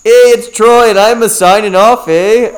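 high, loud mock Canadian accent. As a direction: sign off like a Canadian. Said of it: "I joined Canadian".

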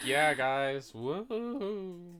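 weak, soft, drawn-out woohoo; deflated attempt at celebrating; half-hearted cheer. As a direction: fake joining the celebration while crushed. Crushed friend faking a cheer.

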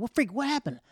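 high, loud, startled question; imitated alarmed friend. Friend after he ran off, shouting in alarm. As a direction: demand to know what happened.

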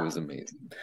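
breathy hushed awe; whispered. Real, not acted. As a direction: breathe it out softly. Trailing awed aside.

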